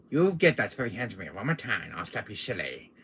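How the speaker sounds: ordinary talk, nothing marked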